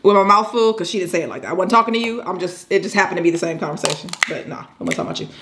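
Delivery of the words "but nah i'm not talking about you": fast whispered aside. Real, not acted. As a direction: whisper it quickly under your breath. Quick aside after a lecture.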